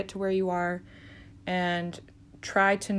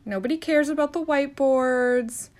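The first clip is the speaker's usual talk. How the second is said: exasperated outburst; 6 semitones higher, 4 dB louder than usual. Acted. Dismissing tattletale nurse.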